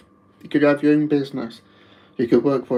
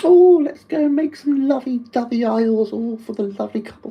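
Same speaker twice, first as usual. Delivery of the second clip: much higher, gushing sing-song send-up; mocking soppy couple; 9 semitones higher, 4 dB louder than usual. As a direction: gush like a sickly-sweet sweetheart to mock them. Mocking soppy Valentine's shoppers.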